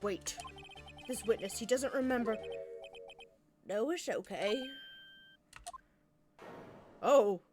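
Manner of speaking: ordinary talk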